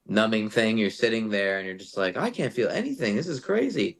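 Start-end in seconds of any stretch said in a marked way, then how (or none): 2.2-4.0 high-pitched acted disbelief; mock amazement. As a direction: marvel in a raised, playful voice. Numb patient voiced in story.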